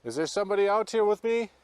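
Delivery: loud, high, rising question; calling out. Real, not acted. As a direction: call into the dark woods for an answer. Calling out in the woods.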